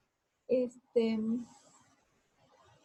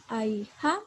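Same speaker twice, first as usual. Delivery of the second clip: "Ay, ja" with the second syllable pushed louder; stress on JA; 5 dB louder than usual. Shown as a demonstration. Teacher showing where the voice rises, "ja" stressed in "Ay, ja". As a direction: raise the voice on "ja" after "Ay". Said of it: "escucho donde elevo la voz".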